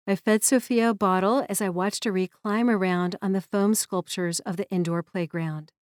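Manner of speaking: usual speaking voice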